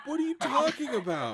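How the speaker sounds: laughing in disbelief; high pitched laugh through words. Real, not acted. Laughs at breathing advice.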